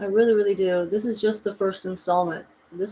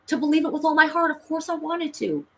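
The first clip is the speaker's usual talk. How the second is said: emphatic insistence; 9 semitones higher, 4 dB louder than usual. Shown as a demonstration. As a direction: stretch it out high, begging to be believed. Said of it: "It's not that I didn't want to believe it".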